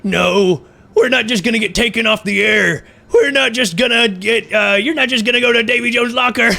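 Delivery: lightly voiced captain, pressing on; Krabs voice. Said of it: "He's like".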